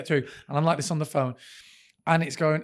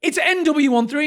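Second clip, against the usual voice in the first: exasperated; 9 semitones higher, 5 dB louder than usual. Retold or imitated. Spelling it plainly in frustration.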